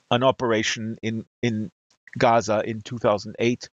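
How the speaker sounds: usual speaking voice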